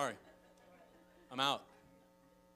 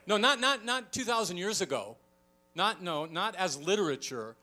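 loud, driving "not" after "not"; emphatic denial; 3 semitones higher, 7 dB louder than usual. Real, not acted. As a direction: hammer each no home. Preacher insisting on the present.

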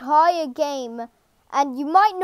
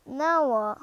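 ordinary talk

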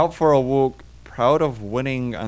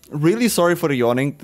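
normal talking voice

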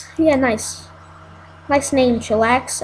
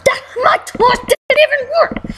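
whiny complaint; 10 semitones higher than usual. Real, not acted. Frustrated gamer losing battle.

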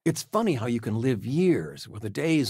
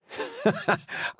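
airy laugh; 10 semitones higher than usual. Acted.

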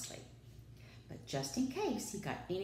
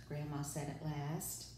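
ordinary delivery

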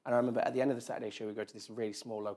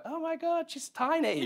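much higher, awed gasp; starstruck amazement; 6 dB louder, slower than usual. Retold or imitated. Meeting Zoe Saldana, awed and much higher. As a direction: starstruck at a tiny film star. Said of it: "really just stood like".

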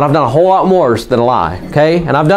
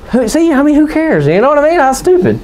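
high-pitched, incredulous dismissal; exasperated scoffing; 9 semitones higher than usual. Real, not acted. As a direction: scoff at how petty it all is, pitched well up. Scoffs at trivial church vote.

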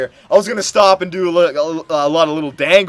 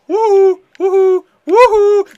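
high, loud woohoos; high-pitched victory cheer; gleeful whooping; 6 dB louder, slower than usual. Real, not acted. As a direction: celebrate a goal with repeated whoops. Celebrates scoring a goal.

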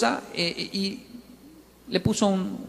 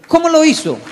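much higher and louder, pointed question; rhetorical shout; 10 semitones higher, 9 dB louder than usual. Real, not acted. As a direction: challenge the whole room. (pregunta gritada al público)

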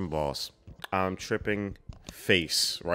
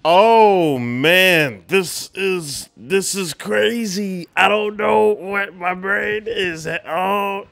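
high, loud and slow, in disbelief; overwhelmed exclaiming; 11 semitones higher, 10 dB louder, much slower than usual. Real, not acted. Viewer reeling at a crazy scene, loud and slow. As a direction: marvel out loud, mind blown.

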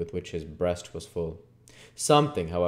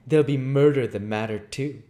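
cool threat, raised in pitch; 6 semitones higher, 8 dB louder than usual. Acted. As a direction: answer menacingly yet coolly. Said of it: "replied the humpbacked man coolly".

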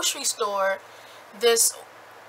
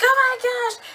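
startled; 13 semitones higher, 5 dB louder than usual. Retold or imitated. Katie sees the bear.

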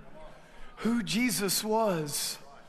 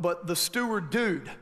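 ordinary delivery

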